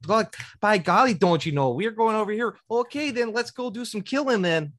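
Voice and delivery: put-on high, loud Minnesota accent, by golly; a dwarf fighter in a hammed-up Minnesota accent. A player voicing her dwarf in Minnesota accent. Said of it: "with, like, a Minnesota accent".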